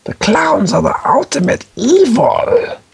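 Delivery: very high, slow, crazed voice; wild unhinged ranting. Pretend. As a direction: shriek it slowly like a madman. Crazed ranting about clowns.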